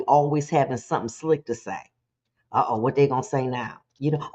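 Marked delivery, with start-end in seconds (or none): none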